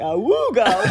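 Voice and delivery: slow, laughing wooga; slow laughing wooga sound effect; silly cartoon sound effect. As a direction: imitate a goofy cartoon noise. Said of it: "sound effects for me".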